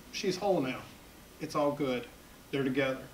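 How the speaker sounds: soft, low-key reassurance; hushed, tender. Retold or imitated. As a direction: say it gently, in short spaced phrases. Imagining mother and child reunited.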